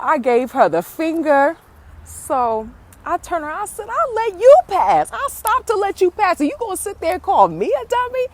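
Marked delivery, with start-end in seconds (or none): 3.9-8.3 high-pitched, indignant retelling; worked-up comeback in a raised voice; upset retort. Retold or imitated. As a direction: re-enact telling someone off, pitch jumping way up. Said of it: "I was so upset".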